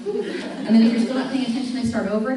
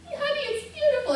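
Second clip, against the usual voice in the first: much higher, fast gushing praise; gushing mom; faster than usual. Retold or imitated. Mother praising her daughter's drawing, high and fast. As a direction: gush over the drawing quickly. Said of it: "All she wanted me to say is".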